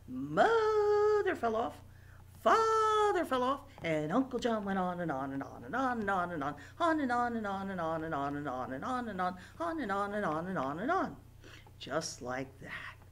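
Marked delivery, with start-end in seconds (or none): 0.1-3.6 high, loud, slow squeal; playful high squeal; squealing fall. Pretend. Bouncing rhyme, falling moment. 3.8-11.2 fast, breathless run of words; speedy galloping chant; racing on and on. Pretend. Bouncing rhyme, racing run.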